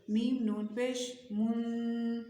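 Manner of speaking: long nasal hum on the last من; ikhfa demonstration. Shown as a demonstration. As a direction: spell میم نون پیش من, humming the final من through the nose. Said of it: "اس کو اخفا کہتے ہیں".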